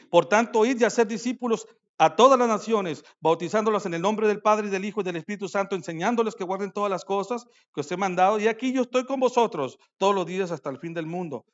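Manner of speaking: loud, lifted reading of the commission; scripture proclamation. Acted. Preacher reading Jesus' command to the church, loud proclaiming read. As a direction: proclaim the verse over the room. Reads the Great Commission loudly.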